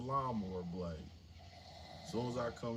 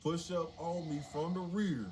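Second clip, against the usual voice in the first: tough talk; 6 semitones higher than usual. Retold or imitated. New inmate warning the yard, defiant bravado. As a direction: puff up and warn them off.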